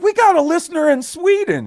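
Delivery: high, loud excited squeal. Retold or imitated. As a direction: squeal it out with glee. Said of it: "I was like".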